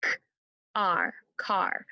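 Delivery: K, R, car tapped out sound by sound; slow sound-by-sound tapping of car; segmented sounds. Shown as a demonstration. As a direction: break car into its sounds, then blend. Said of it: "One more time".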